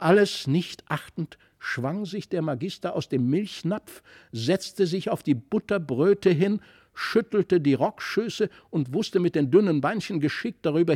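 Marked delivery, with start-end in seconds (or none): none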